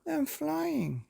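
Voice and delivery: high, delighted cry of flight; gleeful I'm flying; excited exclaim. Acted. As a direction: call out in wonder as you rise. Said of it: "he exclaimed".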